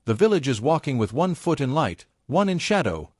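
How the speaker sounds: plain reading voice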